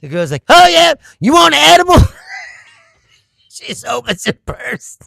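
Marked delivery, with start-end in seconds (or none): 0.5-1.9 loud, high imitation of a friend; hammed-up excited offer; mock shout. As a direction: shout it out like a pushy pal. Retold friend offering edible.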